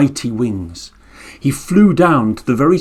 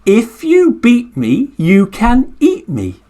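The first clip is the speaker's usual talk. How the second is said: put-on high turtle line drawn out for children to repeat; slow call-and-response; 8 semitones higher, slower than usual. Shown as a demonstration. Storyteller leading the children to chant the turtle's line slowly. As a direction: say it slowly so the kids can join. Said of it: "Can you say that with me?".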